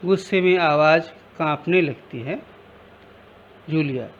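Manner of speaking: ordinary delivery, nothing marked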